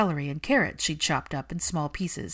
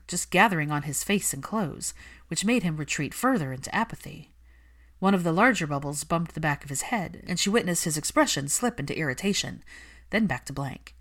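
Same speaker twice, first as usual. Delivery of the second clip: ordinary delivery, nothing marked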